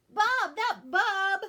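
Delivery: put-on a sick child calling out loud; stuffy-nosed shout. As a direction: shout it slowly through a blocked nose. Sick boy calls out stuffily.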